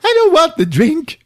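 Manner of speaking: very high voice, laughing through it; giggly falsetto. Retold or imitated. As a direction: squeak it while cracking up.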